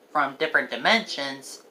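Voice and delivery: ordinary delivery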